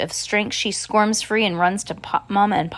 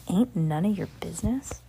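whisper; 4 dB quieter than usual. Real, not acted. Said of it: "he doesn't even speak well".

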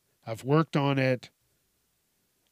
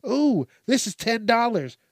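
high-pitched, impressed bargain hunter; mock delight; 9 semitones higher, 4 dB louder than usual. Imitating a buyer spotting a cheap toy, mock delight. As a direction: jump up high with pretend excitement.

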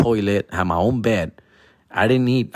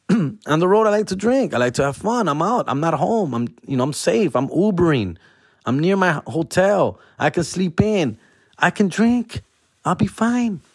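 high carefree; 6 semitones higher than usual. Retold or imitated. Mock carefree reasoning, high.

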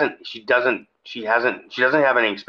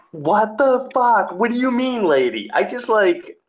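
indignant rant at the new teacher; irritated outburst; 8 semitones higher than usual. Real, not acted. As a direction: vent the outrage, pitch shooting up. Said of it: "I was, like, irritated about it".

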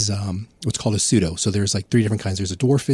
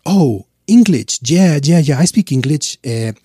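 fake Puerto Rican accent; 3 semitones higher, 7 dB louder than usual. Soldier pitching himself to a Montreal woman in a put-on Puerto Rican accent. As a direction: lay the accent on thick, all charm. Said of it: "I'd bust out".

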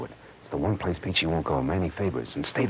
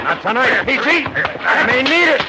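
loud, high, grunting through the struggle; fight-strained taunts to the thug, much higher; straining in a fight; 8 dB louder, slower than usual. Acted. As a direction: speak while throwing punches.

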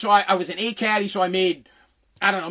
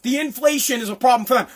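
normal talking voice

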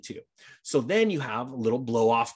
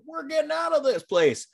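high-pitched mock panicked crowd voice; hammed-up cry of people fleeing, pitched way up; crazy fleeing people. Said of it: "these crazy people coming out of California".

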